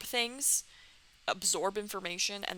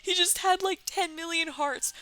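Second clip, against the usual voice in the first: real high, loud laughing disbelief; cracking up; 10 semitones higher, 5 dB louder than usual. Real, not acted. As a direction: squeal it out through laughter. Cracks up at game animation.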